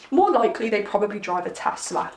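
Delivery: ordinary talk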